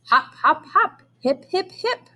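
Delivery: playful bunny hops. A narrator's reading. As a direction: hop the words like a bunny. Bunny hopping steps.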